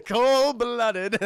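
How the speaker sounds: high, loud words through laughter; cracking up while talking; real laughter. Real, not acted. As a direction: squeeze it out between laughs. Cracks up at the scene.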